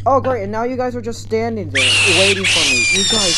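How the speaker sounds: loud exasperated yelling; exasperated shouting. Real, not acted. Player yelling at monsters blocking his way, loud exasperated shouting. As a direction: yell at the monsters in disbelief.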